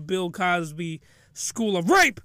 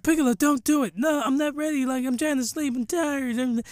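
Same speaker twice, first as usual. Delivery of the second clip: higher, softening sleepy protest; put-on sleepy whine trailing into num-nums; half-asleep woman; 5 semitones higher than usual. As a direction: imitate a drowsy wife fading into mumbles. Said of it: "she's half asleep".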